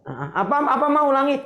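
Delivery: high, teasing husband asking her to repeat; husband feigning deafness to hear it again; mock not hearing. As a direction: pretend you missed it, teasingly.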